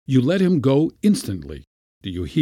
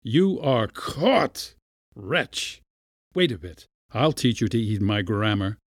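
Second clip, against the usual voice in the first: malignant gloating. A narrator's reading. Latin master to a trapped mouse, gloating malignantly. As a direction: gloat over a helpless victim. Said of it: "looked at the mouse malignantly".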